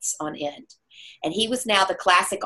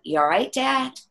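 concerned check; 7 semitones higher than usual. Retold or imitated. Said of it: "And I'm like".

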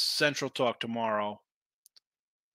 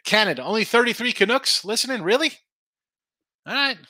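high, loud mock disbelief; 8 semitones higher, 6 dB louder than usual. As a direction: play up being shocked and offended, all in fun. Mock outrage at Canadian listeners.

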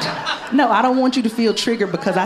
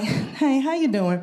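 mock sultry with a runny nose; 6 semitones higher, slower than usual. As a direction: try a flirty line with allergies. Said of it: "being like".

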